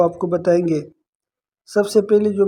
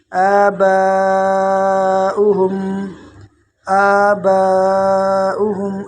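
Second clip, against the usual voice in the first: آباؤہم with the ba drawn out five beats; long, slow chant; melodic recitation; 3 dB louder, much slower than usual. Shown as a demonstration. Teacher chants it slowly.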